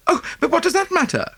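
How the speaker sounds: high, fast, tearful wife's outburst. A narrator's reading. Wife breaks in over her lost necklace, high and tearful. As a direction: break in, voice high and near tears. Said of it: "broke in Missus Oppelson tearfully".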